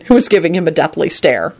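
ordinary delivery

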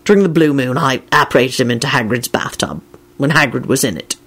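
gloomy. A narrator's reading. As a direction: confess it gloomily. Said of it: "Lupin said gloomily".

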